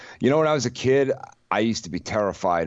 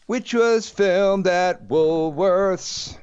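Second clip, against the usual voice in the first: high, drawn-out announcer delivery; theatrical headline announcement; 10 semitones higher, much slower than usual. Acted. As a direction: proclaim the headline slowly in a raised pitch.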